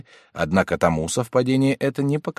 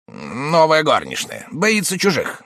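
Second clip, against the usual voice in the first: uncle voice; 9 semitones higher, 4 dB louder, slower than usual. A narrator's reading. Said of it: "сказал дядя".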